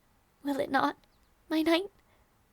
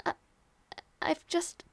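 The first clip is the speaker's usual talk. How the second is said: normal talking voice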